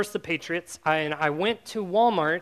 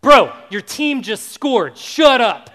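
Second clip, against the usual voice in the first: loud, exasperated shout at him; annoyed shout; 4 semitones higher, 12 dB louder, slower than usual. Retold or imitated. Guy at the party yells at the clueless fan, shouting. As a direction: snap it at him, fed up and loud. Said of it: "suspicious of me all night goes".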